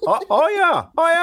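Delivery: put-on high, odd-accent "Oh, yeah"; high mock Canadian-ish "oh, yeah"; weird Tony accent. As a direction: mimic that strange almost-Canadian sound. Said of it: "what accent that is".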